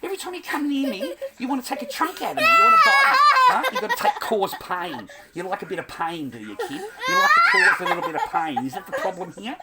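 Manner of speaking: high, laughing mock scold; laughing mock telling-off. Dad mock-scolding his biting kid, laughing. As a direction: scold with laughter breaking through.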